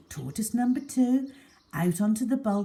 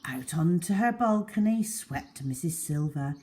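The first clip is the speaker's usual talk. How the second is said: ordinary delivery, nothing marked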